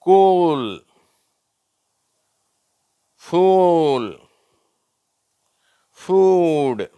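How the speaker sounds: "கூல்", "ஃபூல்", "ஃபூட்" loud and high with drawn-out oo; long oo drill. Shown as a demonstration. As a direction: model each word alone, holding the oo in "கூல்", "ஃபூல்", "ஃபூட்". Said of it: "ஒரு ஒரு வார்த்தையா நான் வாசிக்கிறேன்".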